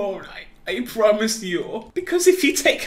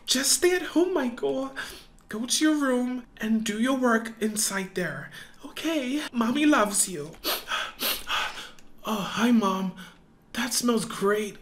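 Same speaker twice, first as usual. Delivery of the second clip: ordinary talk, nothing marked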